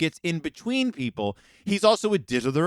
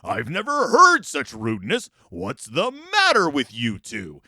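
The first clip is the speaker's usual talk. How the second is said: slow, louder put-on dad voice scolding; stern Papa; 4 dB louder than usual. Papa scolding the two children at dinner, hammed-up stern. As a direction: play the gentle modern dad, mock outraged. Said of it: "but he says".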